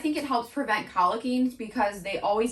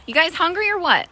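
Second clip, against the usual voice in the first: higher, louder, playful; 6 semitones higher, 6 dB louder, faster than usual. Real, not acted. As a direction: tease them cheerfully. Calling to horses.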